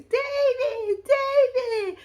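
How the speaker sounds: high, drawn-out squealed name; mock scream. Kenya greeting David at the door, mock scream. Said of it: "she screams".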